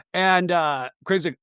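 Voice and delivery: ordinary delivery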